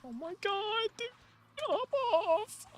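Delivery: squeaked out in a very high falsetto; squeaky outrage. Real, not acted. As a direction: shriek it high like a cartoon. Outraged at the enemy appearing.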